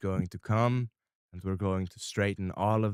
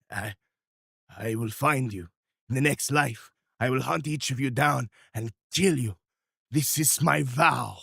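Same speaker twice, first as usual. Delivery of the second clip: louder, higher menacing oath; villain's vow; 4 semitones higher, 4 dB louder than usual. Acted. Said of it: "He looks at you guys and goes".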